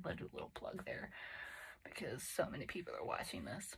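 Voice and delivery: whispered ironic aside; quiet aside. Real, not acted. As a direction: slip it in under your breath. Ironic aside about tiny audience.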